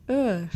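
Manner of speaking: "Heure" with silent h, open mouth; isolated "Heure" demo; model the word. Shown as a demonstration. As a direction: say "Heure" as one open vowel, no h sound. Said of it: "The mouth is opened and the tongue touches the lower teeth".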